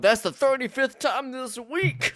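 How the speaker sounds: high, drawn-out mock exasperation; exasperated Queen Mary. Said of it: "her head being like".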